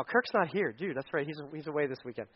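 ordinary delivery

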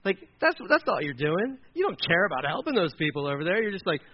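normal talking voice

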